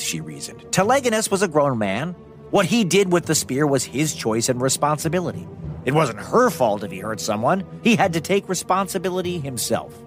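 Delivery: ordinary delivery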